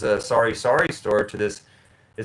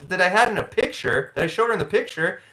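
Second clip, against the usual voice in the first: usual speaking voice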